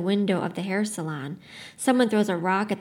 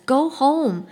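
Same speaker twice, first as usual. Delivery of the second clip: higher and louder, a firm raised plea; urgent plea; 6 semitones higher, 6 dB louder than usual. A narrator's reading. Older man telling the crowd to go home, raised firm plea. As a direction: order them away with force.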